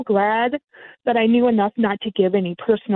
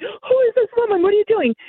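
much higher, a put-on shocked jealous girlfriend; hammed-up fake alarm; 10 semitones higher than usual. Faking jealousy to catch the scammer, hammed-up high alarm. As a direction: play up the fake outrage. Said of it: "and I'm faking it".

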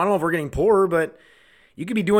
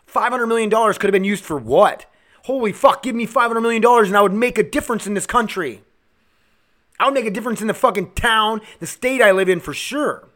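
higher, exasperated insistence; angry rant; 5 semitones higher than usual. Real, not acted. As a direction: vent frustration with raised pitch. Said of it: "That's how I feel".

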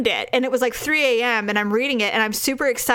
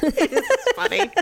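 ordinary delivery

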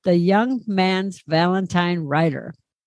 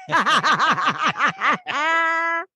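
high burst of laughter; 8 semitones higher than usual. Real, not acted. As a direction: laugh high at your own joke. Laughs at own punchline.

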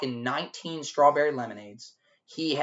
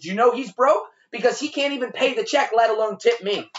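raised, loud taunt at her; taunting yell; 8 semitones higher, 4 dB louder than usual. Retold or imitated. Waiter taunting the girlfriend about her broke boyfriend, loud. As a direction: jeer at her about him.

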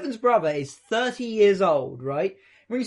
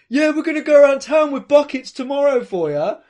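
background heckle; 6 semitones higher, 7 dB louder than usual. Retold or imitated. Drunk friend chips in from background.

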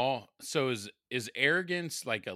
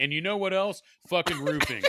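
louder, higher mock rant; hammed-up griping; 7 semitones higher, 5 dB louder than usual. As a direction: play up an indignant gripe for laughs. Acting out someone ranting about roofing.

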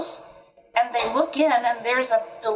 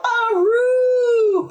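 high, loud, sustained howl; hound howl; 13 semitones higher, 6 dB louder than usual. A narrator's reading. Hound joins the animal band, howling high and loud. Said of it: "The hound howled".

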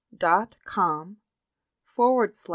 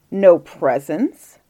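slow, loud disbelief, rising at the end; drawn-out, affronted query; incredulous question; 8 dB louder, much slower than usual. A narrator's reading. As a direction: echo it back in disbelief. Grandfather questions the prince's complaint.

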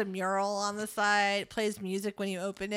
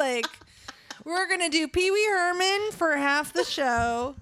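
ordinary talk

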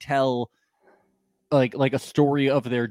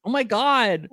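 mock gasp; 8 semitones higher than usual. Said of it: "I was like".

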